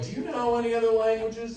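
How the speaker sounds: high-pitched interviewer's question; put-on interviewer, pitched well up; job interviewer. Plays job interviewers asking.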